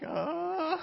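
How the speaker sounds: high strained "uh" of alarm; mock frightened groan. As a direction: imitate a passenger hanging on. Salesman holding on in the spinning car.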